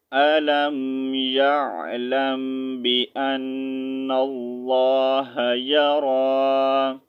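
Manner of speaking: slow measured tilawat of the verse; tajweed recitation, unhurried; Quran recitation. Shown as a demonstration.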